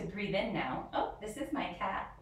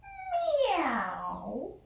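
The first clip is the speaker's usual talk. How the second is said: long, high, sliding meow; high cat meow; 9 semitones higher than usual. Acted. The cat meows to the dog again, long and high. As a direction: stretch out a high kitten meow.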